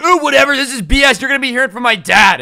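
loud, high whiny tantrum, imitated; mock rage quit. Banned truck guy rage quits the store, mock tantrum. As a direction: storm off in a hammed-up huff. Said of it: "he just rage quits out of there".

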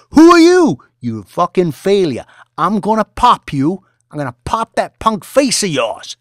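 high, loud sneering tirade; mocking yell. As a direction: humiliate him at full volume. Boss threatening tied trader.

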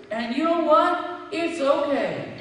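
forceful, driving sermon voice; impassioned preaching. Real, not acted. Preacher telling the congregation praise is okay, impassioned. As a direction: preach it out with fervor.

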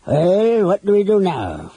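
high, loud grumbler's voice; grumbling. A narrator's reading. Flint to his companions about what to do, grumbling. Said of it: "Flint grumbled".

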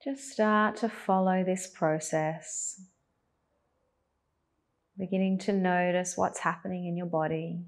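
calm, even cues with long pauses; unhurried, guiding attention to the breath; guided meditation. Acted.

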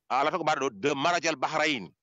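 normal talking voice

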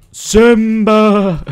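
loud, high, long drawn-out call; mock tragic cry. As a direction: wail the name in mock grief. Mimics Lion King cry.

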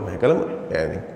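ordinary talk, nothing marked

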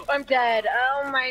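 shocked shout. Real, not acted. Reacts after gasping.

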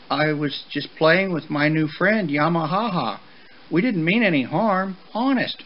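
put-on high, pleading child voice; small voice, earnestly making excuses; meek young colt. Young horse explaining himself to his father, high and meek. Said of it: "Sparky's voice was just barely above a whisper".

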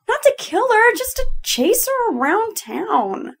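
high-pitched, coaxing justification; pleading, voice pitched right up; wheedling. Acted. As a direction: talk them into a silly request. Acted wheedling for a drone.

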